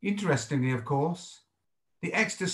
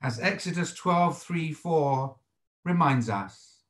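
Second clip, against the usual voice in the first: ordinary talk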